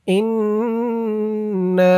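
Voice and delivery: ইন্না with the n hummed long through the nose; slow chant of ইন্না, lingering on the n; obligatory ghunnah. Shown as a demonstration. Teacher models wajib ghunnah, drawn-out nasal n. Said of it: "ওয়াজিব গুন্নাহ".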